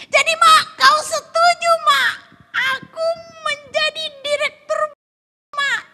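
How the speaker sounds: high, loud hopeful pleading; eager excitement. Acted. Husband asking if his wife now agrees, high excited shouting. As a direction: shout it eagerly, pitched right up.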